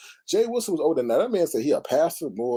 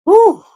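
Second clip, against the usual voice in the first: loud whew; 16 dB louder than usual. Real, not acted. After the clip.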